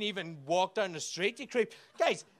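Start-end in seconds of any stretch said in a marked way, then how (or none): 2.0-2.3 exasperated. Real, not acted. Exasperated outburst.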